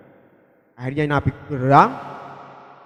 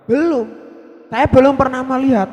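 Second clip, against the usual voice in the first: earnest protest; 8 semitones higher than usual. Retold or imitated. Pilgrim insisting he believes without having seen the Prophet. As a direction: insist with fervour.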